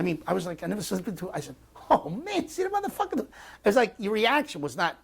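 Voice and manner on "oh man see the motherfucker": much higher, a cry of disbelief; shocked exclamation. Retold or imitated. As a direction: blurt it out as if you can't believe your eyes. Retelling his shocked exclamation in combat.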